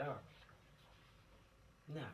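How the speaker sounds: usual speaking voice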